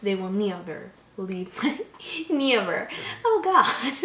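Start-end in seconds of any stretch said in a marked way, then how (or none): 2.1-4.1 breaking into laughter mid-line; laughing. Real, not acted. Reader cracking up at her own dramatic reading, laughing. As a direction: giggle through the sentence.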